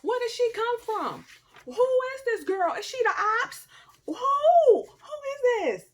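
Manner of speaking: high, loud, near-crying outburst; frantic questions. Retold or imitated. A high, loud cry at the ex's new wife. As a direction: fire off questions, shrill with hurt. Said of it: "I was like".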